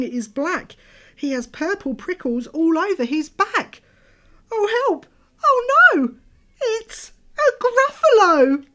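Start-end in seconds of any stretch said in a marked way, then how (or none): 4.5-8.6 terrified squeal. Acted. Mouse meets the Gruffalo.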